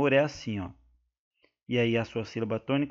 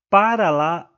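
normal talking voice

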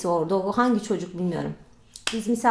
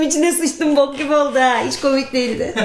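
ordinary talk

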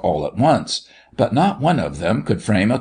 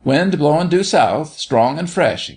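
higher-pitched excited gasp; 7 semitones higher than usual. A narrator's reading. Jimmy to the others after the dancing, excited gasp. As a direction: gasp out the weather news, excited. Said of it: "gasped Jimmy".

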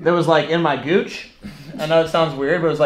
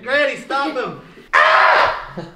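squealing while ticklish; being tickled; 8 semitones higher, much slower than usual. Real, not acted. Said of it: "I'm a little dramatic".

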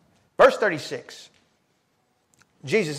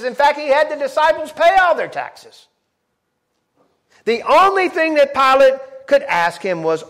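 loud, high preaching at full force; full-voiced pulpit preaching; fervent preaching; 8 semitones higher, 9 dB louder than usual. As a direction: drive it home to the congregation.

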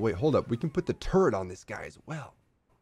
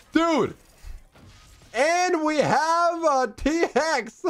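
high, drawn-out excited yell; overjoyed disbelief; 14 semitones higher, slower than usual. Real, not acted. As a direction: crow it slowly at a high pitch. Still reeling from the lucky win.

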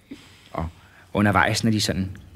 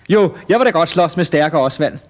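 raised, confident call from the crowd; eager volunteer; 6 semitones higher, 5 dB louder than usual. Acted. Prop answering the showman from the crowd, raised eager call. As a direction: pipe up loudly, ready to fight.

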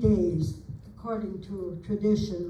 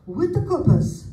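surprised exclamation; 7 semitones higher, 7 dB louder than usual. Retold or imitated. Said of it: "his first comment was".

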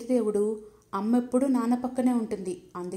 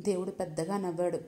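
ordinary delivery, nothing marked

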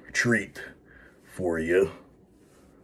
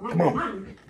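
coaxing high voice; 13 semitones higher than usual. Real, not acted. Coaxing dog onto lap.

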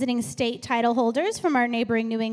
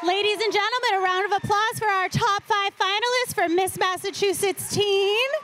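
raised, bright host announcement; pageant host; 6 semitones higher than usual. Real, not acted. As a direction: announce the finalists to the crowd. Host announces top five finalists.

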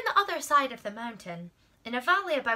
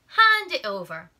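loud, bold demand; 10 dB louder than usual. Acted. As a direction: boom it out with confidence. Confident Hercules takes the sky.

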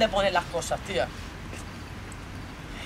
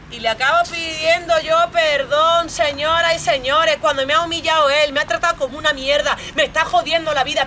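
raised, higher-pitched complaint with stretched words; indignant outburst; 4 semitones higher, 4 dB louder than usual. Acted. Telling ladies and gentlemen how he humiliated them, indignant. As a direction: protest the humiliation to an audience.

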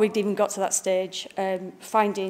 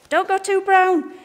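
high, slow mock warning, louder; sales team warning; 11 semitones higher, 6 dB louder than usual. Designer imitating the sales team's notes, high and mocking. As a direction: squeak it out like a fussy sales rep. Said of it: "We get sales as well saying".

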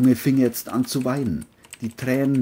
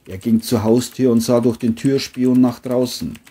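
ordinary delivery

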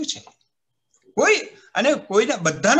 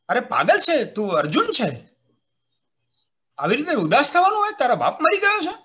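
scolding father; 5 semitones higher than usual. Retold or imitated. Father scolds his dejected son.

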